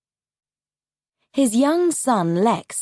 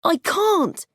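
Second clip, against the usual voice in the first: put-on much higher, scared child's voice; boy afraid in the helicopter, high and scared; frightened boy; 10 semitones higher than usual. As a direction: pitch it up like a terrified nine-year-old. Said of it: "Lex said".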